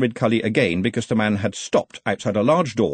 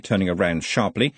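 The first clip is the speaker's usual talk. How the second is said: ordinary delivery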